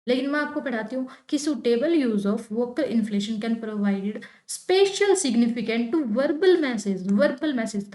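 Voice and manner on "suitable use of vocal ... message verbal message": slow, with "verbal message" pushed louder; stress on verbal message. Shown as a demonstration. Teacher showing students how stress lands on "verbal message". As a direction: punch the words "verbal message". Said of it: "यहाँ पर मैंने किस पे stress दिया? Verbal message पे दिया".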